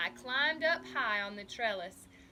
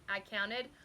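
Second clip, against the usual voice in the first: usual speaking voice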